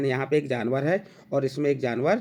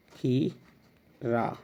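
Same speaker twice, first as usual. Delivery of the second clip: drawn out; 4 dB quieter, much slower than usual. Shown as a demonstration. Teacher dictating word slowly.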